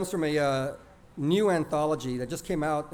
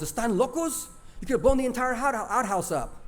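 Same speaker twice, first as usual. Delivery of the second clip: exasperated mom; 7 semitones higher than usual. Retold or imitated. Mom scolding boys.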